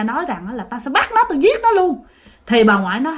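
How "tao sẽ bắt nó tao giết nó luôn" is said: high-pitched vengeful threat; threatening spirit. Acted. Unforgiving spirit refusing grandma's plea, threatening. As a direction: threaten them, voice pitched well up. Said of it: "Mà không chịu tha nha".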